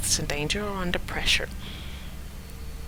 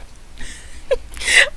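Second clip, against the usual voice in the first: embarrassed laugh. Real, not acted. Laughs at her own accent attempt.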